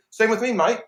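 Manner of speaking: put-on a quick, high, hammy Australian accent; terrible Australian accent. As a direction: toss it off as a bad Aussie impression. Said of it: "that was a terrible Australian accent".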